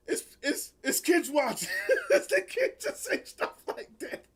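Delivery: high-pitched words squeezed through laughing; helpless laughter. Real, not acted. Host says kids are watching, helplessly laughing. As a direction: try to object while cracking up. Losing it over shocking line.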